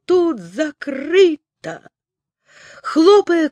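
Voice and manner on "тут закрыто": higher, slow despairing cry; desperate shout. Acted. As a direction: call out in despair. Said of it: "в отчаянии закричал". (крик в отчаянии)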